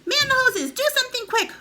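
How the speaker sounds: high, very loud shrieked orders; frantic yelling. A narrator's reading. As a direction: scream for the fire crew. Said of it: "the old woman yelled".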